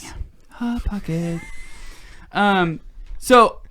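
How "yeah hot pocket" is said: whispered comic catchphrase; Jim Gaffigan. Acted. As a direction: whisper it like a tiny sung jingle. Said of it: "That was my Jim Gaffigan impression".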